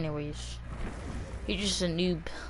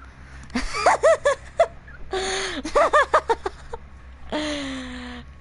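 shrieking laugh; 12 semitones higher than usual. Real, not acted. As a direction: lose it laughing. Friend's joke lands.

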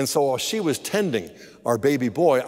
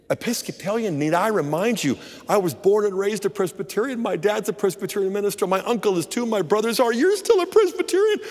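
retold indignant protest, climbing higher to the end; indignant retort; 5 semitones higher than usual. Wife reminds husband of her Presbyterian family, indignant. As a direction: rattle off the family credentials, rising to a high peak.

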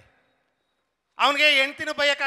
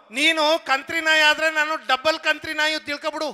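mock quarrel retort; 5 semitones higher, 6 dB louder than usual. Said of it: "ಅಂತಾನೆ".

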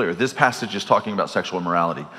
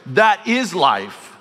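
slow emphatic; 6 semitones higher, much slower than usual. Shown as a demonstration. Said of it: "emphatic repeat".

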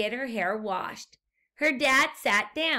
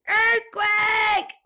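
high, loud alarmed shout; cried out; 11 semitones higher, 8 dB louder than usual. A narrator's reading. Guinea pig explorer warns the pigs, shouting high and loud. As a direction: yell the warning at the top of your voice. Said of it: "cried Fluffy the Explorer".

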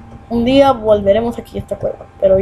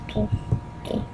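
usual speaking voice

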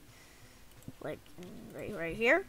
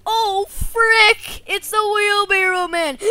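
high, loud panicked cry; hammed-up kid panic; mock alarm; 7 semitones higher, 9 dB louder than usual. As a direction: shout a frightened warning in play. Boy alarmed by attacker.

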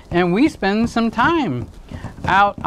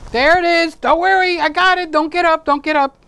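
high-pitched; 9 semitones higher, 3 dB louder than usual. Pretend. Mock reassuring offscreen.

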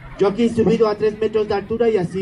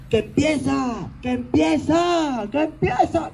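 higher with each cry, the middle one slow; impatient fan shout; 6 semitones higher, slower than usual. Shown as a demonstration. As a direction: call out like an eager fan who can't wait. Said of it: "puedes gritar tú, por favor, algo así como".

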